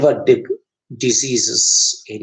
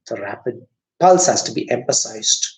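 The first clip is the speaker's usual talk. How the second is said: ordinary talk, nothing marked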